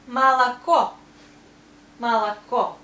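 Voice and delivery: "молоко" as ma-la-KO, loud and clear; first two O's of "молоко" become A; correct vowel reduction. Shown as a demonstration. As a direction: say "молоко" with O only on the last syllable. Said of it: "O we pronounce only here".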